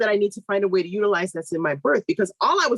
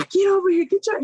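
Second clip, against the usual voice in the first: sharp call; 12 semitones higher, 3 dB louder than usual. Retold or imitated. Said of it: "she said".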